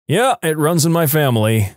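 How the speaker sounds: plain reading voice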